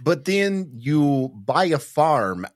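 normal talking voice

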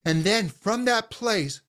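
usual speaking voice

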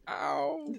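high, soft yelp of pain; understated comic cry of hurt; small pained "ow". Acted. Stabbed lady amid her guards' cheering, feebly yelping. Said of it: "And Agatha Smithy going".

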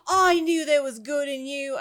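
a lightly attempted Australian lilt; attempted Australian accent. Belle hugging Rumple, shaky attempt at Australian accent. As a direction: try an Australian accent on a heartfelt line. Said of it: "I can't speak with an Australian accent".

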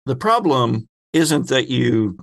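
ordinary talk, nothing marked

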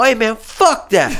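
high, drawn-out mock outrage; indignant retort. Driver talking back to his boss, indignant. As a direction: push back high and slow, half laughing.

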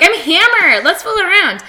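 put-on high, loud party-girl announcement; mock drunken enthusiasm. Woman announcing herself to her date, high mock-drunk enthusiasm. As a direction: blurt it high like a tipsy date. Retells her tipsy opener to dates.

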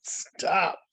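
dramatic yell. Retold or imitated. Ballet teacher stopping the pianist, yelling stop dramatically. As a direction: bark it out to halt the class. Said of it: "she yelled".

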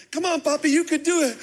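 a hint of a little kid urging dad on; kid cheering. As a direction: kids cheering dad during the run. Kids cheering him on while running.

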